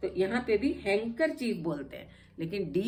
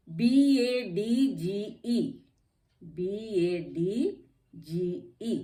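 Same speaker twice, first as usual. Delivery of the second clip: letters spelled out slowly one by one; slow spelling; much slower than usual. Shown as a demonstration. Teacher spelling badge for students, slow letter by letter. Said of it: "अब देखिए डी".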